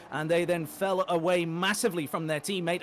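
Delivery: ordinary talk, nothing marked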